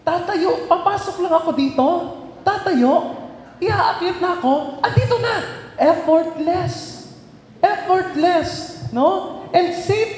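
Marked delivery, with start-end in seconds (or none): 0.0-5.3 high, bubbling with laughter; giddy delight. Retold or imitated. Therese delighted by the elevator. 5.8-8.8 "Effortless" stretched slow and high; drawn-out emphasis. Real, not acted. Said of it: "stressing the key word".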